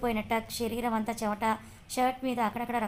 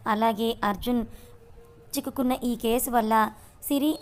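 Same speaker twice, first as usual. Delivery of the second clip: louder, punchy teaser read; channel outro; 5 dB louder than usual. Acted. Narrator urging viewers to follow the channel, energetic outro. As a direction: hype up the next episode for listeners.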